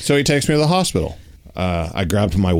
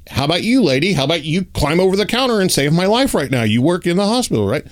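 exasperated demand; 7 semitones higher, faster than usual. Retold or imitated. Demanding help from desk lady.

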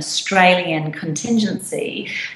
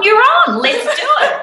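much higher, laughing eager agreement; excited yes; 4 dB louder than usual. Retold or imitated. Chef agreeing to masks on stage, laughing excited. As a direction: toss it back, delighted and game. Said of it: "And he's gone".

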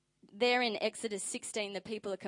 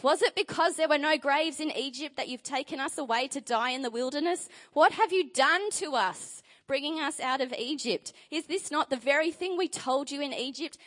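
loud, high, accusing outcry; angry complaint; 6 semitones higher, 6 dB louder than usual. Acted. Preacher voicing terrified Israelites accusing Moses, loud and high. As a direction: hurl it at Moses like a panicked crowd.

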